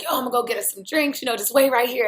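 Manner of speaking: imitating the friend heading for drinks; casual ex-friend. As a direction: toss it off fast. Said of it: "And he's like".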